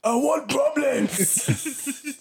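high, very slow, drawn-out taunt; mock tough talk. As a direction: stretch it out like a taunt. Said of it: "asks for hate".

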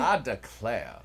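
hammed-up, loud Southern drawl; Southern accent. Host answers plan for Southern accents, hammed-up Southern accent. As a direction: play it up as a mock Southerner. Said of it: "this whole episode in Southern accents".

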